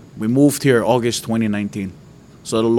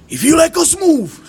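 angry yelling at God. Retold or imitated. Man in his closet yelling at God, high and crying. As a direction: shout it up at God, upset. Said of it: "This is how I talk to God".

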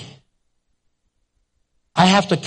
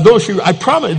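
ordinary talk, nothing marked

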